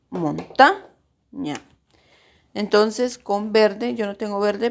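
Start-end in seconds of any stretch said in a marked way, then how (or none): 0.1-1.6 mon-TA, pause, ña, with TA lifted; correct-syllable stress. Shown as a demonstration. Teacher showing students how montaña is said, stress on TA. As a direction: slowly stress the middle TA of montaña, then drop ña. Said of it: "dice montaña".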